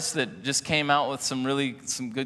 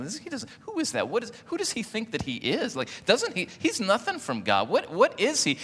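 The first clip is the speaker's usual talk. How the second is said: high-pitched scoffing Pharisee; a sneering snob muttering to himself; indignant Pharisee; 9 semitones higher than usual. Retold or imitated. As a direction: squeak with offended disbelief. Pharisee's indignant thoughts voiced.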